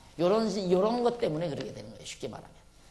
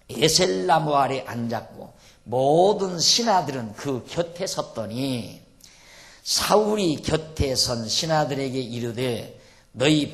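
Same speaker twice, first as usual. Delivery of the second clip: slow, loud pulpit reading of the text; scripture reading; 7 dB louder than usual. Acted. Preacher reading the Bible passage to the congregation, slow and loud. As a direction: proclaim the verses slowly and loudly.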